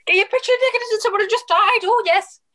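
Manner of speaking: high-pitched hammed-up Irish shout; very high, excited fake Irish accent; mock Irish. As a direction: squawk it in a silly Irish voice. Hamming up the mock Irish character.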